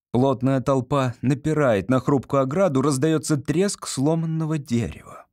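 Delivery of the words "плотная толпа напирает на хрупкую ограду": ordinary delivery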